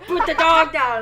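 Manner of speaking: loud police loudspeaker voice; intercom voice. Pretend. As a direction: bark it like a megaphone order. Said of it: "can you use the intercom voice to say".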